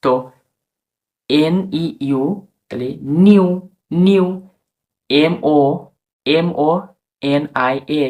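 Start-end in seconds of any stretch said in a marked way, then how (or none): 2.7-4.3 নিউ begun on N, drawn into a long "iu"; "niu" with silent P. Shown as a demonstration. Teacher builds the word, emphatic নিউ. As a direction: say নিউ loud and clear, no P. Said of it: "ইউ উচ্চারণ হয়".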